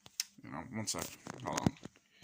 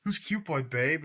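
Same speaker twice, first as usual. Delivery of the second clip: high, louder suspicious whine; jealous boyfriend; 7 semitones higher, 6 dB louder, slower than usual. Retold or imitated. Boyfriend quizzing Samantha about Cute Boy, high mock whine. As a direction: squeak it out like a jealous partner. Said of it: "It's like".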